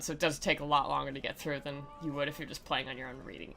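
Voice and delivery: ordinary delivery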